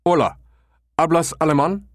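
a German tourist struggling with Spanish; thick German accent. A narrator's reading. Becker posing as a German on the phone, thick German accent. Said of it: "affected his Spanish with a thick German accent".

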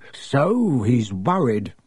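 slow, savoured conclusion; a touch of grim relish; grim satisfaction. A narrator's reading. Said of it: "with grim satisfaction".

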